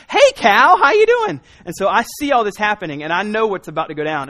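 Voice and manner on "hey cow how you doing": put-on squeaky high little-girl voice, much higher and louder; cheery little girl greeting. Little daughter happily greets the Chick-fil-A cow, high and squeaky. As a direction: chirp it up bright like a fearless toddler. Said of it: "She's like".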